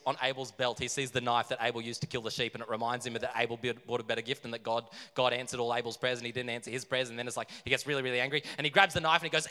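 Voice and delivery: ordinary delivery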